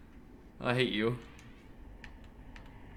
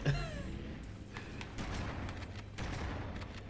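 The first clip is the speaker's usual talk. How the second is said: real laugh that is all air, no voice; silent laugh; 13 dB quieter than usual. Real, not acted. Gamer laughing at his buddy, silent laugh. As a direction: laugh without sound at your friend.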